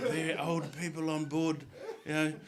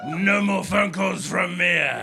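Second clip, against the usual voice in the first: loud declaration; 3 semitones higher, 8 dB louder, slower than usual. Retold or imitated. Santa finishing the call.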